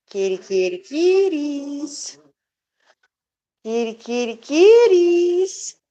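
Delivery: kitty call rising high and loud on kitties. Real, not acted. Owner calling the cats, last kitties high and loud.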